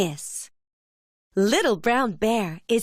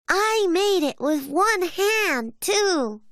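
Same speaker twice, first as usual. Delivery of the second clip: high-pitched little cub showing off; little bear cub; 9 semitones higher than usual. A narrator's reading. Little bear shows the sausage he made, high cub voice.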